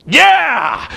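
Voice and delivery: high, drawn-out mock battle cry; hammed-up rallying yell; fired up. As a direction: imitate a wrestler firing up. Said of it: "he'd fire up".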